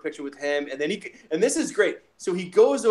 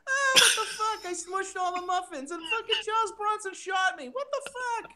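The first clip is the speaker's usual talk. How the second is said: high, crying mock wail of a thug; hammed-up crying shout, much higher; whiny victim voice. Mock voicing a Death Wish victim.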